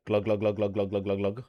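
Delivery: a playful imitated glug sound; pouring glugs. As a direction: mimic liquor pouring freely.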